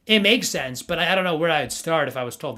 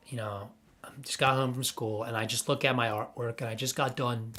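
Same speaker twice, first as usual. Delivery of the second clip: whispered; 5 semitones lower, 9 dB quieter than usual. Real, not acted. Dreamy childhood memory, whispered aside.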